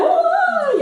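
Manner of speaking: put-on high wailing "ay" like a bawling toddler; mock child wail. Preacher imitating a defiant toddler's tantrum, high mock wail. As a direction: squeal out a toddler's cry.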